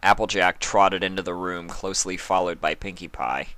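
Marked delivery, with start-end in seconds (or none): none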